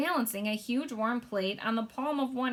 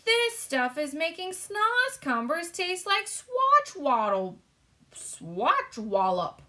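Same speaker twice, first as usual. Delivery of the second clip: much higher, slow, excited giant voice; delighted giant; 9 semitones higher, 5 dB louder, slower than usual. A narrator's reading. Giant raving about the food, excited. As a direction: gush like a thrilled giant. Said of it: "he cried".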